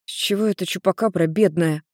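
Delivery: ordinary delivery, nothing marked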